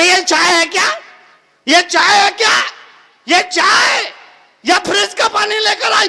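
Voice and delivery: loud, high mother-in-law's scolding; angry grumbling. Acted. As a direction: rant loudly about the tea in rising indignation. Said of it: "बहुत बड़बड़ाई". (ऊँची आवाज़ में सास की झल्लाहट)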